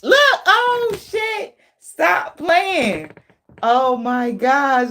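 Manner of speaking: high, slow, breaking with laughter; shrieking with laughter. Real, not acted. Reader reacting to a funny card, shrieking with laughter.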